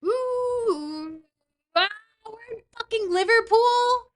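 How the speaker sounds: loud, high whoop of amazement; excited yell. Real, not acted. Streamer finding the round is in Liverpool, excited yell. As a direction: cry out in high, thrilled disbelief. Thrilled reaction to landing in Liverpool.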